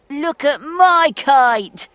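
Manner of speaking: loud, slow, high excited call; Danny crying out. A narrator's reading. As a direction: call it out proudly, high but not squeaky. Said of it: "cried Danny".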